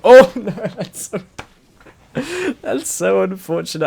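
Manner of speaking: high laughing cry of dismay. Real, not acted.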